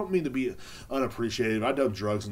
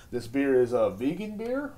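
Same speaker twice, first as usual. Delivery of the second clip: usual speaking voice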